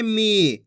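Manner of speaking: मी drawn out on the vowel; long ee in मी. Shown as a demonstration. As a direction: hold the ई of मी. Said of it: "खींच खींच के पढ़ना है". (मी की ई खींचकर)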